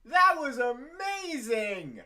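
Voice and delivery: loud, amazed cry; excited shout. Real, not acted. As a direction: shout it out in awe. Reacting to the touchdown.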